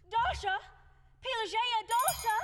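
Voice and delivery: loud, high calls for the servants; calling out. Acted. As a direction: shout for help across the house.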